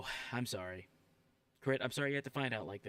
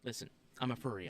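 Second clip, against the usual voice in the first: normal talking voice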